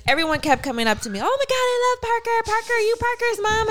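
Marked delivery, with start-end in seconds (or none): 1.2-3.7 high, fast, excited gushing; imitated gushing fan. Party guest meeting Parker's mom, high excited gushing. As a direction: gush like an overeager party guest.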